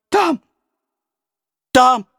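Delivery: put-on high, shaking frightened child; trembling voice. A narrator's reading. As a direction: gasp it out in terror. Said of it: "произнёс он дрожащим голосом".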